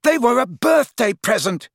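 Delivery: high, indignant shout. A narrator's reading. Dangling lad defending his chocolates, shouting. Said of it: "shouted Ron".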